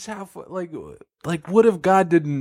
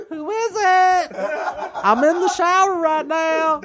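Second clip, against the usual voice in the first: higher, slow, laughing God; God in the shower; 13 semitones higher, slower than usual. Acted. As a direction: call out high from the shower.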